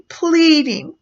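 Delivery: ordinary talk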